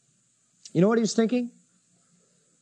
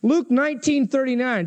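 usual speaking voice

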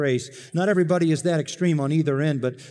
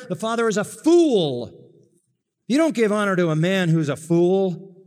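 indignant, loud condemnation; a Pharisee fuming at the feast; outraged Pharisee; 6 semitones higher, 4 dB louder than usual. Acted. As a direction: denounce the celebration with scorn.

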